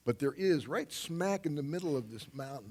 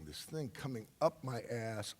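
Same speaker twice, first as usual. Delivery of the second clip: ordinary delivery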